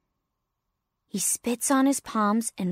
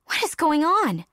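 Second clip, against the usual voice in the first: baffled adult, pitch raised moderately; puzzled demand, higher than usual talk; bewildered logger; 5 semitones higher than usual. Acted. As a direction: ask it confused and startled.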